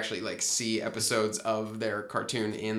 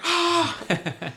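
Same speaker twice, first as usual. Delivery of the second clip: mock yell; 5 dB louder than usual. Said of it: "they're like".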